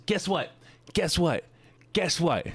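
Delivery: eager, high-pitched teasing repeats; excited. Real, not acted. Streamer about to announce an event to chat, excited. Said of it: "I'm excited for this one".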